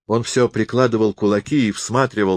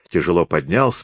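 usual speaking voice

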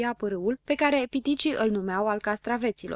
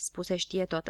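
usual speaking voice

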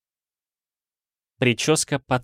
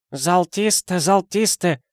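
put-on high, eager boy's voice repeating the word; gleeful high-pitched boy; happily confirming boy; 10 semitones higher, slower than usual. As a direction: chirp it out like the delighted little boy. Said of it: "радостно подтвердил китайчонок".

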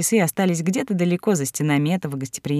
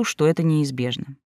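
ordinary delivery, nothing marked

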